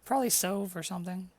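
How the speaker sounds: high, chatty inn host voice; friendly innkeeper. Acted. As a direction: welcome guests as the innkeeper.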